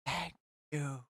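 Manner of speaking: a hint of a happy little boy; quiet little kid. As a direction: murmur a shy thank you. Son thanking the baker.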